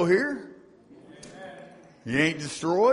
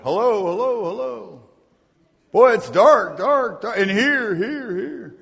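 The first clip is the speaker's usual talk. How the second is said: lost in the dark; 5 semitones higher, 4 dB louder than usual. Pretend. Voicing someone lost in dark.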